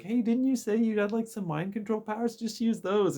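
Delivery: high, chirpy girl voice, lightly mocked; perky March. March urges the player to use mind control powers, high chirpy voice. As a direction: pitch way up and sell it as a bright idea.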